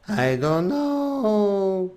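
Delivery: very slow, sleepy drawl; drowsy slow cartoon drawl; Slowpoke Rodriguez impression. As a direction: stretch it out like a tired cartoon mouse. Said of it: "talking like this".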